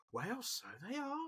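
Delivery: light Essex twang, grandma; lightly imitated Essex grandma; Essex accent. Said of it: "in a Essex twang".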